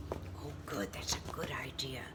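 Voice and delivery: whisper. Acted. Narrator whispers approving aside.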